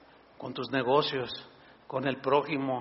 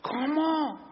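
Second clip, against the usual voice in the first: surprised; 9 semitones higher than usual. Retold or imitated. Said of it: "Tú dices".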